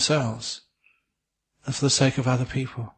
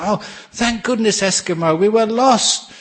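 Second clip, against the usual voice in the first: relieved excitement; 8 semitones higher, 4 dB louder than usual. Acted. Lost men greeting rescuer.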